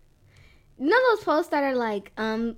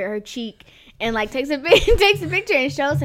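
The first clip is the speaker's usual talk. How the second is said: usual speaking voice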